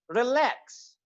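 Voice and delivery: Relax with drawn-out, stressed "LAAX"; long second vowel. Shown as a demonstration. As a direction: stretch the second vowel of relax after a short "ri". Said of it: "vowel sound आ है उसको मैंने long किया है".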